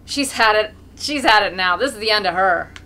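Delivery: loud gloating call; loud shout. A narrator's reading. A sport in the crowd gloating over the mare, shouting. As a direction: call it out with relish. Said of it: "One of the sports shouts".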